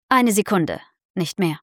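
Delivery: ordinary delivery, nothing marked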